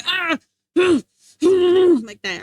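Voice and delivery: frustrated grunt and loud huff; mad growl. Retold or imitated. Streamer re-enacts waking up really mad, frustrated growling. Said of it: "I was, like, really mad".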